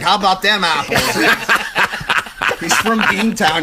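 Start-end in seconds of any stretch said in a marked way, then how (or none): 0.0-1.0 a kid's mock Boston accent, laughing; hammed-up Southie kid line; imitating a Boston toddler, a bit louder. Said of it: "the kid's like".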